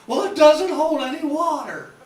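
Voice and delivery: high, loud, squeaky huckster pitch; helium salesman voice. Retold or imitated. Imitating Mister Haney's helium voice to sell a water tank. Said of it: "he always had that voice like this".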